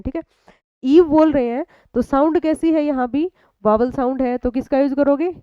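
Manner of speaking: ordinary talk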